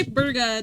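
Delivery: burger said as BUR-guh, final R dropped. Shown as a demonstration. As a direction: imitate a non-rhotic burger ending in uh. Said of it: "how to say burger".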